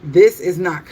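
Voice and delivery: ordinary talk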